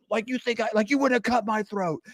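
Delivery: high squeaky scoff; mock scoffing. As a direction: scoff at the rival's naive trust. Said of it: "it's like".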